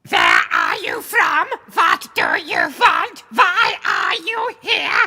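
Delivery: high, loud, slow robotic shouting; harsh alien exterminator interrogating a newcomer; Dalek voice. Pretend. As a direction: bark it out like a Dalek. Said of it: "to the Dalek thing".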